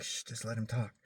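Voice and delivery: hushing. Retold or imitated. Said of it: "he's like".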